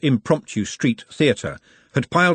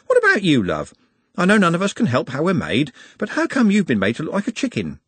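higher-pitched, friendly nosiness; chatty woman passenger; 7 semitones higher than usual. Acted. Woman questions bird-like sister, chatty. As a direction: ask a tactless question sweetly.

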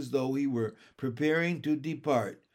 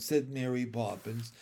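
plain reading voice

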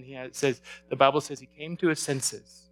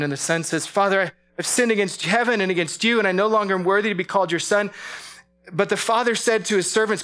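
rising, louder and higher as the father runs; preaching at full force; 5 semitones higher, 5 dB louder than usual. Acted. Preacher retelling the prodigal son's return, building to full force. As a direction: let the joy build, pushing the pitch up.

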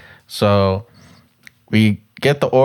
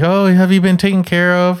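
mock sweet barista, pitched way up; high girly worker voice; 9 semitones higher than usual. Retelling the barista checking on the car, high mock voice. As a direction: squeak it like a chirpy cashier. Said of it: "she's like".

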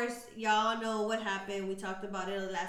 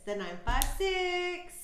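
hyped; 8 semitones higher, 5 dB louder, slower than usual. Real, not acted. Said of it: "shout out to the 956".

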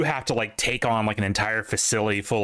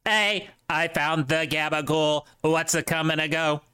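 put-on Italian-American accent; 5 semitones higher than usual. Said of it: "Italian accent".

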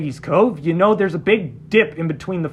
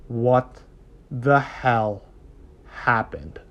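stern officer; 7 semitones lower, 5 dB quieter, much slower than usual. Retold or imitated. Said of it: "she goes".

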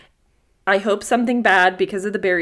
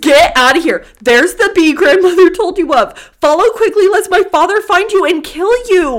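urgent warning; 8 semitones higher, 7 dB louder than usual. Acted. Reading boy's urgent warning aloud.

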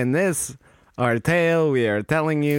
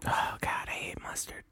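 unvoiced, hushed aside; whisper; 13 dB quieter than usual. Real, not acted. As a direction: whisper it like a secret. Whispered aside about mustard.

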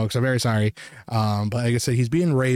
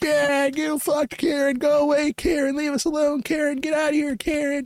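jeering crowd. Retold or imitated. Shoppers heckle the woman blocking the aisle, high jeering mimicry.

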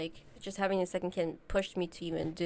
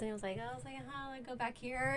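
awkward mic voice; 4 semitones higher, 11 dB quieter than usual. Shown as a demonstration. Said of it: "I was talking like this".

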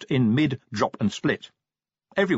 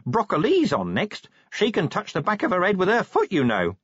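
matter-of-fact, pitched up; chatty Nobby; 10 semitones higher than usual. Acted. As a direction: reassure your sergeant in a perky common voice.

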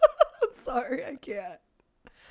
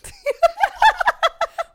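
sudden loud high laugh; high burst of laughter; 7 dB louder than usual. Real, not acted. Cohost cracking up after the caller hangs up, bursting into laughter.

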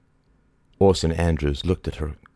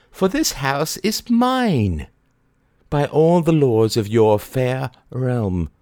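triumphant odd Mary; 9 semitones higher, 4 dB louder than usual. A narrator's reading. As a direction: claim the house gloatingly. Mary voiced.

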